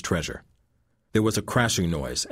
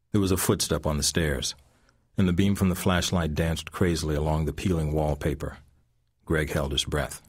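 ordinary delivery, nothing marked

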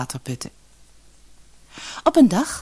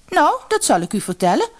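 high, indignant reply; angry; 10 semitones higher than usual. A narrator's reading. Daughter answering her mother after the mishap, angry. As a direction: answer crossly with the pitch up. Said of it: "antwoordde de dochter boos".

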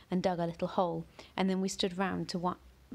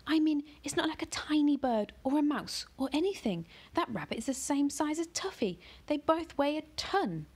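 high, exasperated mother's voice; mother exasperated, pitched up; mother scolding; 9 semitones higher than usual. A narrator's reading. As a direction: scold the cat in a lifted, disbelieving tone.

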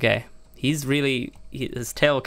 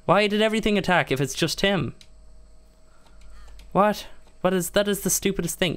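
exasperated; 7 semitones higher than usual. Real, not acted. Game plan failing.

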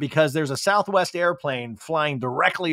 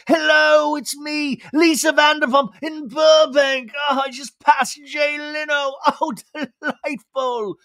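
much higher mock shout, grand and slow at the end; Lisa Vanderpump; 11 semitones higher, 3 dB louder than usual. The host arriving at a modest house, in a shouted grand voice. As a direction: announce yourself like visiting royalty. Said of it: "she's like".

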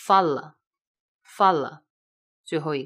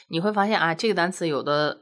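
normal talking voice

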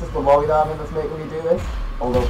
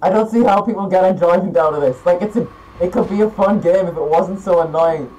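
normal talking voice

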